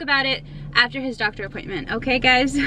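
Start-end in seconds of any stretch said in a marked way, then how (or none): none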